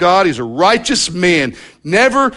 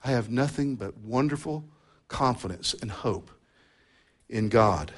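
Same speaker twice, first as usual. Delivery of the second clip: much softer, lower mock reassurance; pretend everything's fine; 5 semitones lower, 13 dB quieter than usual. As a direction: brush it off, softly faking calm. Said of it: "as if".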